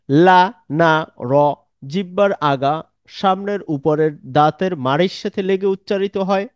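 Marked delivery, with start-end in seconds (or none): 0.1-1.3 "ঝা সা স" sounded slowly with the tongue tip; slow letter demo. Shown as a demonstration. Teacher shows students a letter group by where it is made, slowly. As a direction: draw each letter out to show where it is made.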